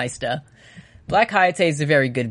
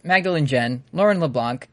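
ordinary talk

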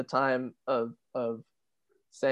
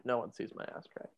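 whisper; 2 semitones lower, 12 dB quieter, faster than usual. Real, not acted. Suddenly whispered joke.

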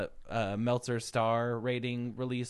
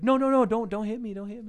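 high, mock-panicked begging; caricature pleading; 9 semitones higher, 5 dB louder than usual. Wrestler cartoonishly begging not to be hit, mock panic. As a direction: burst out loud and high, cartoonishly scared.

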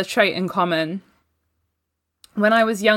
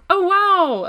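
mock delight; 11 semitones higher than usual. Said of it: "it was, uh, like".

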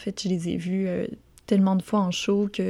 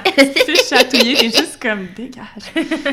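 annoyed outburst; 5 semitones higher, 10 dB louder than usual. Retold or imitated. Acting out annoyance at the cat.

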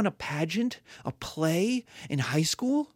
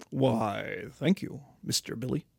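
dim barbarian; 5 semitones lower than usual. Acted. Barbarian answers lord.

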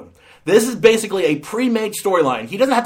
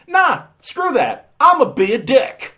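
high, loud dismissive quote; brash and higher, tossing it all away; mock defiant; 8 semitones higher, 4 dB louder than usual. Reviewer voicing the actress ditching her goodwill, mock defiant.

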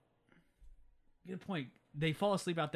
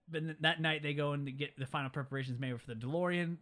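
ordinary talk

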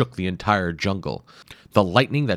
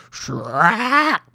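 high sharp effect shout; shrack; 12 semitones higher, 5 dB louder than usual. A narrator's reading. Narrator voicing a blade strike. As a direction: spit out a slicing sound.

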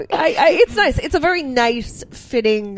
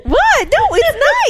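very high, slow protest; defensive surprise; 5 dB louder, much slower than usual. Real, not acted. Co-host accused of being cold, high defensive protest. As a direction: squeak out a defence. Defending herself against teasing.

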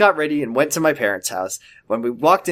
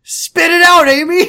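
loud high demand; shouting; 8 dB louder than usual. Acted. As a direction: bark it out much higher and louder. Mom demanding an answer.

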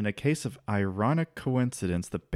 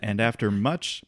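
usual speaking voice

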